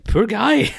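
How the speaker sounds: high, loud outburst of pity; exclaimed pity. Real, not acted. Co-host sticking up for Donnie jilted at the altar, loudly pitying. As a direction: cry out in sympathy for him.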